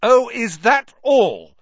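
loud, high, slow sarcasm; angry. A narrator's reading. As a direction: throw it back angrily, drawn out. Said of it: "said Harry angrily".

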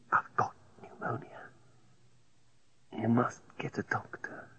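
faint hoarse whisper; low hoarse voice. A narrator's reading. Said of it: "talk in a low, hoarse voice".

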